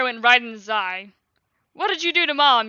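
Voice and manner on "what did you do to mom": louder, higher angry demand; yelled. Acted. Violet yelling at the dragon about her mom. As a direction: yell it at the dragon. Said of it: "yelled Violet".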